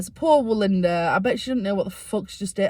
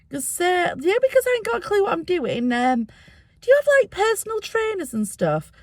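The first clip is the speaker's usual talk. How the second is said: lightly imitating her own nervous self, higher; a touch of a flustered high voice; 10 semitones higher than usual. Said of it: "retold question to Linda".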